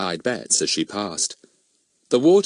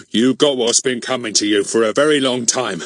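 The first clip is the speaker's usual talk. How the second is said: louder, out-of-breath gloating; triumphant through heavy breath; panting; 3 semitones higher, 5 dB louder than usual. A narrator's reading. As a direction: pant it out as if winded. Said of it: "he panted".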